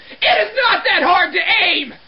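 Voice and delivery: loud, punched indignation; exasperated yell. Acted. Cleaner to men who miss the urinal, exasperated yell. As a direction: hammer each word in comic outrage. Ranting about puddles under urinal.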